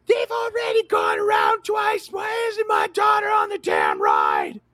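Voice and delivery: very high, loud shrieking complaint; hammed-up screech of entitlement; mock yelling Karen. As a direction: imitate an outraged mother screaming at staff. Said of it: "she's yelling at this guy".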